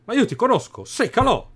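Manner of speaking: high, loud accusing cry; accusing shout. Acted. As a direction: point him out loudly. Guardia riconosce il delinquente.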